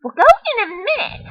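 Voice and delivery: put-on squeaky high eager kid's yes; high girl voice. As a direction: shout it up high like an excited little girl. [name] answers the dog.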